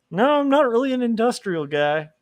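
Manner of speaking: high, airy, dismissive; breezy Dracula. Retold or imitated. Dracula turns down eager goth kid, high and breezy. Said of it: "the guy's like".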